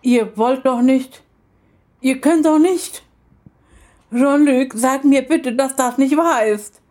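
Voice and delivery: a grandmother pleading with her son in dismay; stammering alarm. Acted. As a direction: stumble over the words in shock. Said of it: "stotterte sie".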